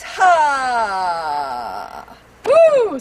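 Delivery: big release shout then high whoop; explosive exhale into a high woo. Shown as a demonstration. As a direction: let the breath burst out loud. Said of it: "do a bit of a zip up".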